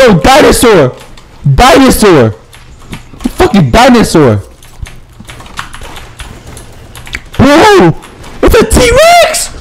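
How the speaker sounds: high, amazed exclamations; astonished shouting. Real, not acted. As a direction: shout in disbelief at a huge sighting. Astonished at sighting a dinosaur.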